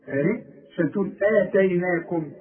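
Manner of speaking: ordinary talk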